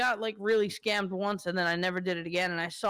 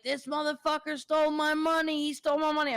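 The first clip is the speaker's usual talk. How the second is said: yelling after the thief, pitch raised but not full volume; retold scream; 8 semitones higher than usual. Retelling chasing the thief who grabbed his cash, mild mock yell. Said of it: "I was screaming at him".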